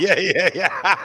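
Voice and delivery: high, loud yeahs through laughter; laughing yeah. Real, not acted. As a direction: laugh the agreement out. Laughing at the Tasmania joke.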